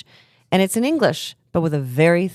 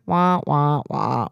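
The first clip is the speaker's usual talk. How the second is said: sad trombone; 2 semitones lower, slower than usual. Retold or imitated.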